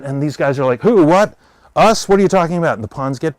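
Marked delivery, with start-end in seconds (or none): 0.8-2.7 high, loud feigned shock; mock innocent surprise. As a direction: play dumb as if wrongly accused.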